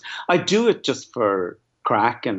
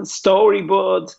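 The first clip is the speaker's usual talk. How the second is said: slow, drawn-out Dublin greeting, pitched up; Dublin accent; 7 semitones higher, 4 dB louder, much slower than usual. Shown as a demonstration. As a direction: put on a playful Dublin accent, stretched out. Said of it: "when you do a dub one".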